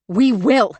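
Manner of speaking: loud, firm declaration; determined shout. A narrator's reading. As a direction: shout down any doubt. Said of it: "shouted Virginia".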